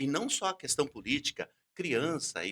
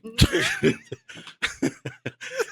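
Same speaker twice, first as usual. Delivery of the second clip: laughing; 8 semitones higher, 12 dB louder than usual. Real, not acted. Self-mocking laugh.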